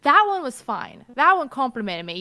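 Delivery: put-on British-Australian accent drifting toward American; indignant mermaid in a muddled Australian-British accent; unplaceable accent. Mermaid snapping back about where they are from, in an odd mixed accent. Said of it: "I can't quite place the accent".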